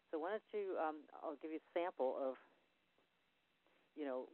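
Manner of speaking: usual speaking voice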